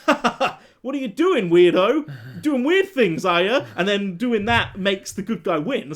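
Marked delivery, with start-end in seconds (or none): 0.1-3.7 a hint of a taunting villain, higher and louder; mocking villain. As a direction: sneer at the weirdo with a mock laugh. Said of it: "the bad guy's like".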